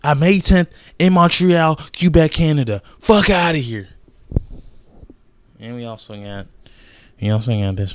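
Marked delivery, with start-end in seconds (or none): 0.0-3.9 loud, high hype call of the fight; hype announcer. Real, not acted. Vlogger hyping a title fight to viewers, loud announcer call. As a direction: announce it like a big title reveal.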